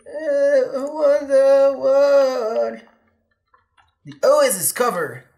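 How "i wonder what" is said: slowed-down voice. Pretend. Slowed distorted voice.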